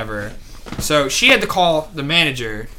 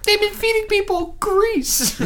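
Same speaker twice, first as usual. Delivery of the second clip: very high, slow silly squeak; squeaky high mock voice. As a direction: say it in a cartoon squeak.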